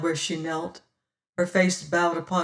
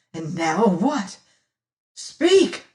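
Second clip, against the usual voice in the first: furious demand; 2 semitones higher, 5 dB louder, slower than usual. A narrator's reading. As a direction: demand an answer in fury.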